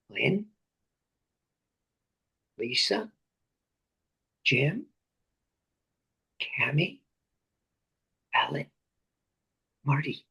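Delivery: low, soft call of each name, one by one; God softly calling names. Acted. God calling the listeners by name, low and soft. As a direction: call each name gently, with long pauses. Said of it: "And then you hear God say".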